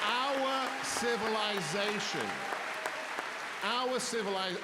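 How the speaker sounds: high, slow, booming rally oratory; loud, drawn-out rallying cry; impassioned declaration. Real, not acted. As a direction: thunder it at the crowd, stretching each word. Rallying climax to a live audience.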